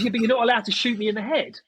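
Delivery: high-pitched whining-player imitation; mock indignant protest. Hit player arguing back about headshots, mock indignant. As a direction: mimic a player arguing the rules.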